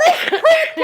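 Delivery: high mock-irate outburst; retold road rage. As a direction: mimic cursing at another driver.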